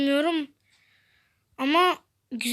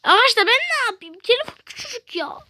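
squeaky complaint; 8 semitones higher than usual. Acted.

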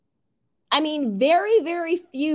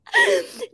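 squealing laugh; 4 dB louder than usual. Real, not acted. Laugh at comment.

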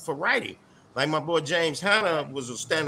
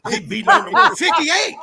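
laughing; 12 semitones higher, 7 dB louder, slower than usual. Real, not acted. Laughing joke.

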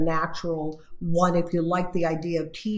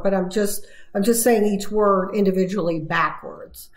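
usual speaking voice

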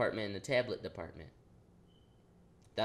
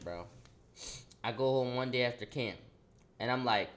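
ordinary talk, nothing marked

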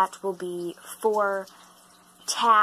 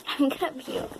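disgusted, about to gag; 5 semitones higher than usual. Real, not acted. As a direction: let the nausea linger. Reacting to nasty candy.

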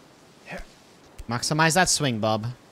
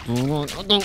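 normal talking voice

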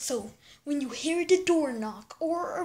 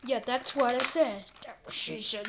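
normal talking voice

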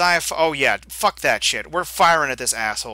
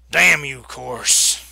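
angry shout; 8 dB louder, much slower than usual. Acted. Josiah confronts the killer.

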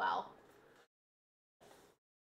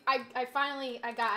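usual speaking voice

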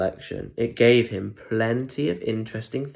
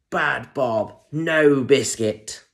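slow, stern scolding of the dog; scolding parent; 3 semitones higher, 5 dB louder, much slower than usual. Acted. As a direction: tell the dog off firmly.

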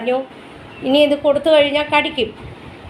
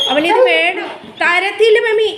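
voicing the dog; 6 semitones higher than usual.

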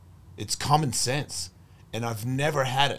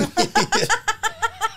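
loud high burst of laughter; big laugh; 5 dB louder than usual. Real, not acted. Laughing at his story.

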